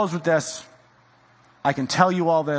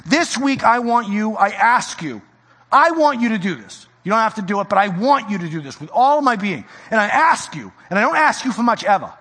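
louder, pleading sermon voice; earnest preaching; 6 semitones higher, 4 dB louder than usual. Acted. Pastor begging the congregation, earnest preaching. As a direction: ask them with all your being.

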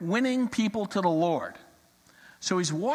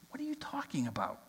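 quick hushed whisper under the breath; whispered aside; 4 semitones lower, 12 dB quieter, faster than usual. Acted. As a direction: mutter it to yourself, barely voiced. Farmer muttering aside to himself.

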